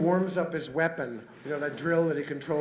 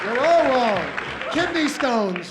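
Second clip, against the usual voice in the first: high, much louder mock shout; loud rebuttal; 6 semitones higher, 9 dB louder, slower than usual. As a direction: shout them all down. Another voice in the argument.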